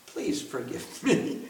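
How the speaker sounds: low, very soft pleading; hushed plea. Acted. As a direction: beg forgiveness almost under the breath. Repeats plea, now hushed.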